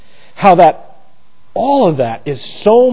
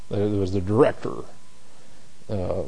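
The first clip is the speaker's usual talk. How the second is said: ordinary delivery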